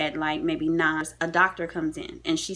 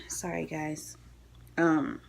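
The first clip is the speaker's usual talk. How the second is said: hushed, choked-up voice; quiet, nearly whispered, close to crying; real tearful apology; 4 dB quieter, slower than usual. Real, not acted. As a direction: apologize softly through tears. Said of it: "yeah, I'm a crybaby".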